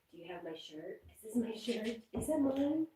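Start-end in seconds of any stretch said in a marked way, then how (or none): 1.2-2.9 high, rising teasing questions; playful pet talk. Real, not acted. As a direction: tease the dog, pitch climbing high. Teasing the dog over shirt.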